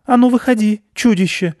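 put-on high, loud little girl squeak; a small girl bravely squeaking a challenge; piping brave cry. A narrator's reading. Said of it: "храбро пискнула Аня".

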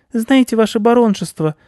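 very high, loud squeaky mouse; humble tiny-mouse voice; sheepish mouse. A narrator's reading. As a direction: pipe it up meekly as a little mouse. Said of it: "потупился мышь".

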